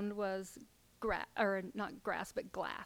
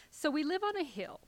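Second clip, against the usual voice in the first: ordinary delivery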